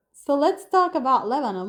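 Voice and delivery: ordinary talk, nothing marked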